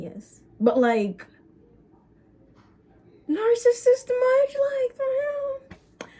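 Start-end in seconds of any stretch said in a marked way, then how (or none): 3.3-6.1 slow, much higher, mocking; sarcastic disbelief. Real, not acted. Mocking the ex, slow sarcastic high disbelief. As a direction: drag it out in scornful disbelief.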